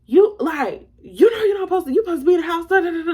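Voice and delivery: high, loud scolding, trailing into nonsense syllables; mama yelling. Retold or imitated. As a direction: imitate mom telling off her child. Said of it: "she's yelling at me".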